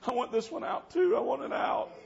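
high-pitched, insistent tearful demand; imitated crying. Girl having her piercings pulled out, imitated crying demand. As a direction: mimic a weeping teen pleading. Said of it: "started crying".

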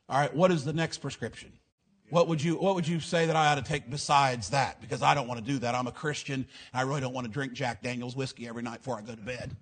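soft, low-pitched, gently tactful refusal; kindly and politely decline, keeping the voice down; nice, sweet, diplomatic. Acted. Patient tactfully turns down a doctor's prescription, sweet and diplomatic. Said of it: "real nice and kind and sweet and diplomatic".

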